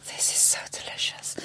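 whispered mock delight over food; retold whisper. Eater praising the old food to his host, mock whisper. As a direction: imitate someone whispering in rapture.

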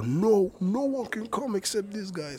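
high, drawn-out mock guard voice; guard turning people away. Guard to the artist's companions backstage, imitated blocking voice. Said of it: "så jag vaktade och bara".

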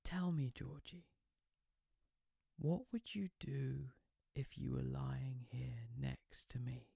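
soft, slow, breathy flirting; sultry whisper. Acted. Phoebe teasing George on the phone in a slow whisper. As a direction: draw him in slowly, in a whisper.

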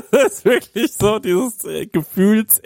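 laughing high while talking. Real, not acted. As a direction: giggle through the words. Laughing at drunk friend.

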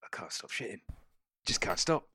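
lightly voiced grumbling pirate; a hint of a desperate crewman; chuntering. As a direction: mutter it to himself, fretting. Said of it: "you can hear him chuntering away".

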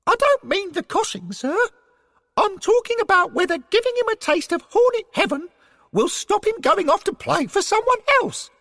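very high, hurried squeaky character. A narrator's reading. Derek explaining his worry to his superior, high-voiced. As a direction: pipe it up, pressing the point. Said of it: "in his high voice".

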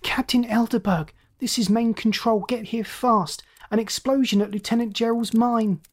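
high, strained lookout shouting; urgent lookout shout. A narrator's reading. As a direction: call it urgently over the intercom. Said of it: "strained with excitement, shouting".

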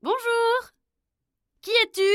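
voix de princesse très aiguë, forte, chantante; salue-le joyeusement de la fenêtre; princesses enjouées. Acted. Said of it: "elles s'exclamèrent". (princesses qui interpellent Pierre en s'exclamant)

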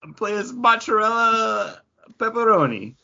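hamming up a silly Italian name; playful high joke. Pretend. Joking about Italian names.

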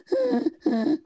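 high sharp gasp for air; winded gasp. Retold or imitated. Retelling being winded on the road, gasping. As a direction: gasp like the wind was knocked out of you.

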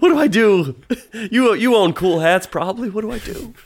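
high-pitched pleading, played for laughs; hammed-up helpless appeal; mock helpless older man. Older man asks a cool-hatted youth for advice, mock helpless. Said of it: "an older man be like".

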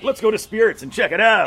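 high, loud excited rallying; mock eager tripper. On mushrooms in Toronto, urging a comedy club visit, excited. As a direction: pitch it up like you can't wait. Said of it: "I'm like".

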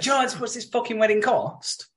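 very high-pitched retold interjection; mock outburst. He pipes up over the phone, mock-outraged. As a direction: pipe up in high mock indignation. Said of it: "he's piping up over, over the phone".

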